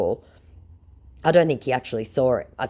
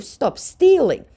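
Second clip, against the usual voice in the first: mock scolding; 14 semitones higher, 5 dB louder, much slower than usual. Scolding scammer in her message.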